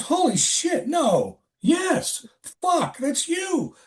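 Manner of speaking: very high, stunned, slowing at the end; floored disbelief. Retold or imitated. Coworker realizes it's the narrator, very high and floored. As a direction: gasp it out.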